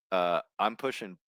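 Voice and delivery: normal talking voice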